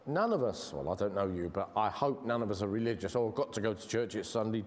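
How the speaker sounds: ordinary talk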